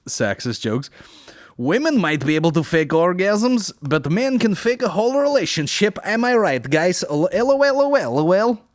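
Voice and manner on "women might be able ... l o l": high, loud mock Russian accent. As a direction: play a Russian joke teller. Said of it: "a Russian accent".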